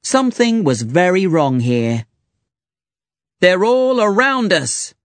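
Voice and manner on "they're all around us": higher, urgent battle cry; rally the troops with a raised call; shouted. A narrator's reading. Teddy shouting to the exhibits, raised battle cry. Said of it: "Teddy shouted to the other exhibits".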